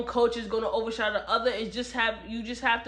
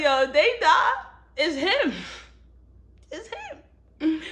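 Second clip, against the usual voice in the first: laughing accusation; 4 semitones higher, slower than usual. Retold or imitated. Reader joking that Raphael is the problem, laughing. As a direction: crack up while blaming him. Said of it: "I'm like".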